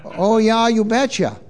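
sing-song Minnesota lilt; Minnesota accent. Acted. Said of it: "as they say in Minnesota".

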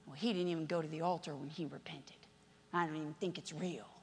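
low, soft doubting grumble; a hint of a sour churchgoer under their breath; muttering judgmental churchgoer. Church member doubting the boy's repentance, low and soft.

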